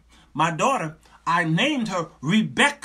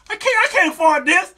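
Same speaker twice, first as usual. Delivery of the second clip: high, loud protest; hammed-up exasperated outburst; mock panic; 11 semitones higher, 8 dB louder than usual. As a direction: wail comically about money troubles. Retells panic at pregnancy news.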